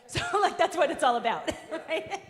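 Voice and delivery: laughing. Real, not acted. Laughing at her own remark.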